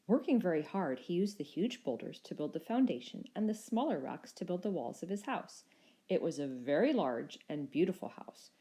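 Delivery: ordinary delivery, nothing marked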